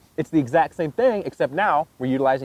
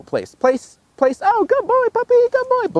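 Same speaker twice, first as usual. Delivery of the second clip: crisp command bursting into high, gushing praise; squeaky high-pitched dog praise; excited praise after command; 4 dB louder than usual. Shown as a demonstration. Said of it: "We say the command, and then we praise it".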